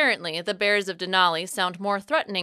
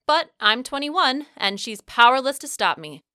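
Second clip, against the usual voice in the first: plain reading voice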